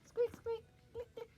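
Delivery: high squeaky dolphin sounds; dolphin squeaks. Pretend.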